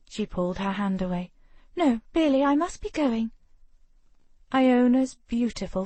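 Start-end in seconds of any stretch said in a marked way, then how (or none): 1.7-3.3 put-on flustered young lady. Ravenna flustered, escaping.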